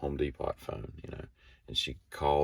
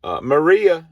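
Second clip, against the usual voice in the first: high, loud call on the radio; walkie-talkie call; 13 semitones higher, 11 dB louder, slower than usual. Retold or imitated. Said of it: "She's like".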